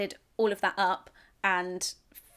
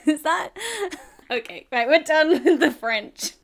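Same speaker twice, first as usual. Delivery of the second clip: laughing; 6 semitones higher, 8 dB louder than usual. Real, not acted. Laughing, ending French.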